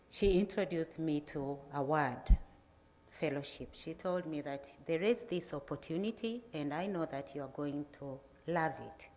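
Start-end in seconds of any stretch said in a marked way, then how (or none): none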